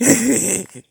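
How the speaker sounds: high, loud burst of laughter; real laugh at a memory; embarrassed laugh. Real, not acted. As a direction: laugh at yourself, a little sheepish. Laughs at own crush memory.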